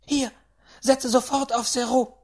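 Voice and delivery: high, loud order; commanding great-aunt. Acted. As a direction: order it done at once.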